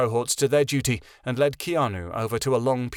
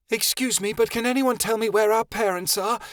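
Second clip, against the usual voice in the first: much higher called-out question; asking loudly; 10 semitones higher than usual. A narrator's reading. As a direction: call out to the crowd of adults. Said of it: "Chameleon asked loudly".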